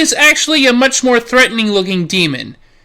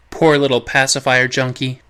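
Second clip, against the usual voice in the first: mock pity; 11 semitones lower, 5 dB quieter than usual. Mocking pity aside.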